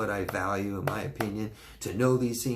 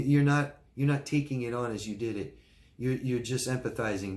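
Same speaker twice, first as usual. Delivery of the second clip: ordinary delivery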